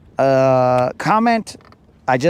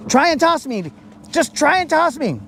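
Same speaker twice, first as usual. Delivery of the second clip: higher, insistent goading; taunting challenge; 7 semitones higher than usual. Retold or imitated. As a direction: egg them on, pushy and smug. Nizook taunting again.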